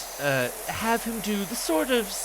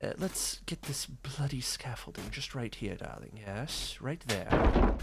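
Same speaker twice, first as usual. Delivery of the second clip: breathy whisper, softer, as the vampire; whispered fake Astarion; 5 semitones lower, 10 dB quieter than usual. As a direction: murmur conspiratorially as Astarion. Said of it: "do my fake Astarion".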